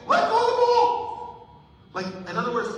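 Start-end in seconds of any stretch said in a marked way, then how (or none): 0.1-1.5 loud, high sideline shout; yelling. Retold or imitated. Dad to his son at fourth grade basketball, exasperated yell. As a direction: holler it slowly at the player. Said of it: "I'm yelling at Bentley".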